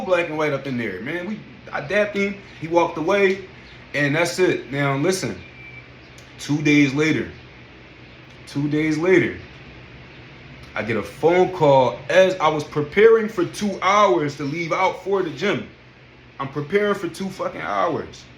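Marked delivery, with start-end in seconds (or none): none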